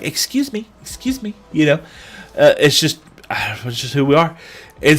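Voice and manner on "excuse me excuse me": high, soft mock "excuse me"; high, thin mocking excuse me; mock pushy customer. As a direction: mimic a fussy diner, pitched up. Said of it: "we are not people who are gonna be like".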